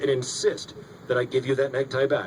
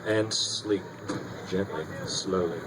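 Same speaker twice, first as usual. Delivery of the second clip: soft, drawn-out hypnotist voice, sinking lower; hypnotic sleep command; 8 semitones lower, 5 dB quieter, slower than usual. Acted. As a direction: lull them down into sleep. Hypnotist putting subjects under.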